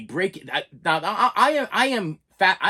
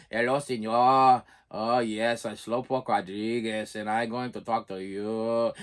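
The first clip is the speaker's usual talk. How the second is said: low, slow cartoon drawl; Slowpoke Rodriguez; 9 semitones lower, 3 dB quieter, slower than usual. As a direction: drag every word out like a sleepy cartoon mouse. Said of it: "remember Slowpoke Rodriguez".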